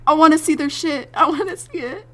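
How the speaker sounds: high, loud gushing; hammed-up can't-wait squeal; eager excitement. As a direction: gush like an impatient, thrilled fan. Reenacting eager excitement at fan's art.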